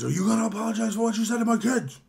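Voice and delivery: fast, high imitation of an irate man; hammed-up angry demand, rapid and high; mock angry demand. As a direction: rattle it off in a high, indignant put-on voice. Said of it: "it's making him angry".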